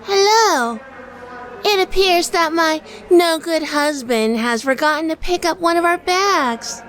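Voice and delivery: high, sugary pretend-wife act; high, bright fake-innocent wife voice; put-on sweet wife voice. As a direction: play the fussy wife for the clerk. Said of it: "I passed them the claim check".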